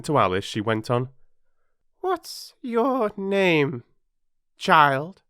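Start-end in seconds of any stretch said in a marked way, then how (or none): 2.0-5.2 high, slow, drawn-out demand; imperious Queen. A narrator's reading. Queen questioning Alice, imperious high drawl. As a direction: question the child imperiously, stretching the words high.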